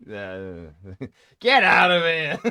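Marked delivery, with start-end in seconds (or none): 1.4-2.4 loud, high laughing protest; playful shout. Real, not acted. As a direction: brush off the compliment with a shout. Bashful at a compliment.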